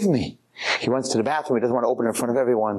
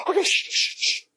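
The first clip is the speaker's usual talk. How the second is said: self-hushing. Acted. Man hushing his own excitement.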